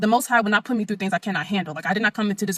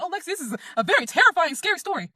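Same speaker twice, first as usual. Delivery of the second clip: mock gushing listeners; 7 semitones higher than usual. Mocking what people say.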